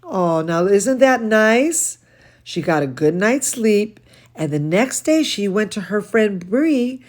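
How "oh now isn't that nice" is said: ordinary delivery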